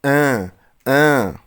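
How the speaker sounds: ان drawn out slowly as a nasal 'ã'; ان twice, slow and nasal, no n sound; slow nasal demo. Shown as a demonstration. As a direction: slowly model ان as a pure nasal vowel. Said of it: "بتتنطق ايه؟".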